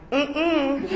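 high, laughing "mm-mm"; defiant refusal. Retold or imitated. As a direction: refuse with a playful no. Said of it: "rebellious and like".

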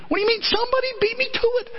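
very high, rapid mock outrage; indignant wife. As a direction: mimic a wife cheated out of scolding.

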